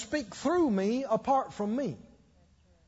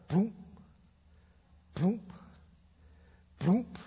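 imitated thud; slower than usual. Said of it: "And I, I heard".

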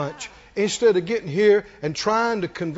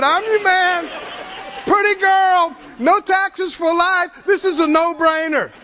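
eager boast; 7 semitones higher, slower than usual. Retold or imitated. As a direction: crow it like a young fighter itching to start. Acts out David eager for Goliath.

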